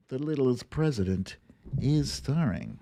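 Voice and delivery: ordinary talk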